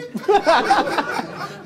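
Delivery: real laugh, high and loud; high burst of laughter. Real, not acted. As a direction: crack up at your own punchline. Laughs at his own retort.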